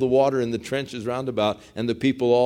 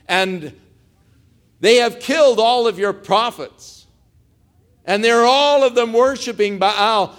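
loud, higher-pitched complaint to the Lord; Elijah's lament; 8 semitones higher, 5 dB louder than usual. Retold or imitated. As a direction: plead your case, climbing louder and higher. Acts out Elijah's aggrieved complaint to God.

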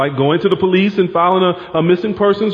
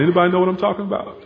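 ordinary talk

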